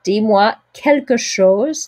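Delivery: quelque chose with both final E's voiced; "Dis-moi quelque chose", each E said like je; slow, rhythmic "quelque chose" with the E sounds; song pronunciation. Shown as a demonstration. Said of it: "the E on the end".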